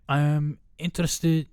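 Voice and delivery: put-on a slow, strange false start at a British accent; botched accent. Quoting the actor's interview in a botched accent. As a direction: drag out I'm interested in a voice that misfires. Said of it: "fuck, what was that?".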